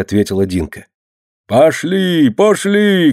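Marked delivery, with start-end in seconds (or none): 1.5-3.1 high, drawn calling out; calling from yard. A narrator's reading. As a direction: shout for them from outside. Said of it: "кричал со двора Кулёша". (протяжный крик со двора)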